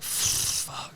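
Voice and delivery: whispered curse of dread; dismayed whisper. Retold or imitated. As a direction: mimic a kid swearing under his breath. Son reacting with dread, retold.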